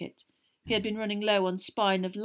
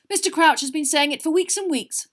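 quick interjection, higher and louder; swift; 8 semitones higher, 8 dB louder than usual. A narrator's reading. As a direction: jump in promptly in a raised voice. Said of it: "said Percy swiftly".

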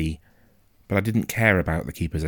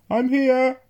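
high, thin distant call; 5 dB louder than usual. A narrator's reading. Hidden father answering his son, calling out. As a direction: answer from far off in the wood. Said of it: "the voice called out".

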